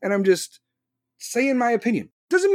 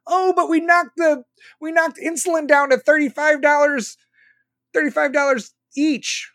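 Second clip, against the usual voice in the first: higher, louder, smug mock boasting; 6 semitones higher, 3 dB louder than usual. Mocking the other side's insulin boast, high and smug. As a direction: parrot their bragging in a high smug voice.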